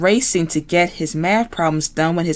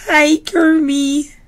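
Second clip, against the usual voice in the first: much higher slow sugary pig voice; very sweetly; 11 semitones higher, 4 dB louder, slower than usual. Acted. Miss Piggy on the phone to Kermit, very sweet. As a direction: sweet-talk him, slowly and high. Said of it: "said Piggy very, very sweetly".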